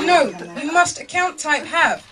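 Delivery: slow, loud, high refusal; stern shop woman. Retold or imitated. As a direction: imitate an unbending clerk laying down the rule. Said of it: "She was like".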